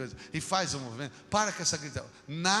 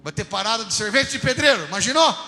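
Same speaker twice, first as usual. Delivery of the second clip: loud, high-pitched incredulous build; exclaiming; 6 semitones higher, 9 dB louder than usual. Real, not acted. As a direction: raise the voice at an absurd idea. Mocks an imagined workers' parade.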